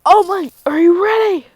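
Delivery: high, loud call to a partner; big, high-pitched hero's shout; acted rallying shout. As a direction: yell the question as a battle rally. Deku repeats his call more forcefully.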